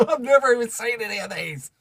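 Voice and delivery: high-pitched, incredulous outburst; amazed disbelief. Real, not acted. Streamer sees gifts never seen before, high astonished disbelief. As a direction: voice shooting up in amazement.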